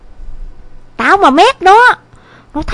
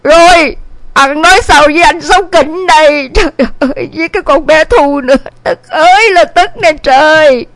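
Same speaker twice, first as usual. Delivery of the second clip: mildly acted, aggrieved lament; wife's tirade; 5 dB louder than usual. A narrator's reading. Wife tells husband their son ran off, exasperated and lamenting. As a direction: unload complaints on husband with exasperation. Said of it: "bắn như mưa vào ông".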